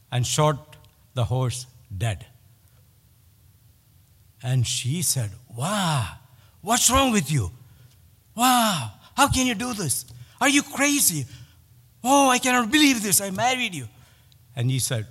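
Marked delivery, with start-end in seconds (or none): 5.5-13.9 a wife aghast at her husband; shocked outrage. Retold or imitated. Wife shrieking in disbelief at her husband.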